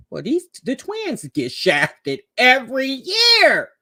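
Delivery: emphatic quote. Retold or imitated. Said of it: "I said".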